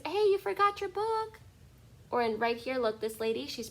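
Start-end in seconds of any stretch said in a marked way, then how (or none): 0.0-1.4 put-on much higher, louder kid voice; kid in picture calling out. Teacher voicing a child in the picture, high and bright. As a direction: call out like a helpful child. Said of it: "look, it's".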